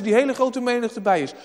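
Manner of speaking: ordinary talk, nothing marked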